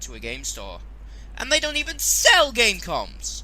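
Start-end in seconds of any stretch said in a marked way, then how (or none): 1.3-3.4 indignant outburst. Real, not acted. Indignant outburst about game store.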